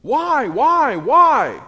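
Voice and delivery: hammed-up anguished wail; anguished complaint. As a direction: cry out to God in despair. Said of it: "he just addresses God and says".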